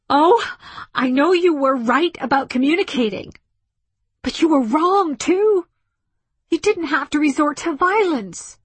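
high, loud, impassioned shouting; wild outburst. A narrator's reading. Woman arguing back at the ship, shouting wildly. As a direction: let it pour out uninhibited. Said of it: "feeling the wildness that smothered her inhibitions".

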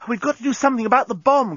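fast, high-pitched plea; urgent cry. A narrator's reading. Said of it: "cried Nettie".

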